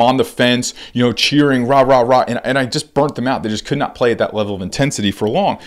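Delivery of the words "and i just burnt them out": usual speaking voice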